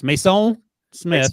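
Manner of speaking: slow, drawn-out French pronunciation; French accent. Shown as a demonstration. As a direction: say Mason the French way, slowly. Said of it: "I'm gonna get my French accent ready here".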